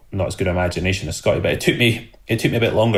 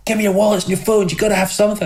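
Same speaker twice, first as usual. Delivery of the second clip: higher, mimicked robber demanding wallets; imitating the robber; 12 semitones higher, faster than usual. Robber at the car window, mock demand for wallets and phones. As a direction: play the robber pushing for anything of value. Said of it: "the guy's going".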